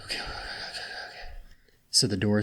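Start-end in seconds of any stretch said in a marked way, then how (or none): 0.0-1.3 whispering. Acted. Creatures nervously agree behind door.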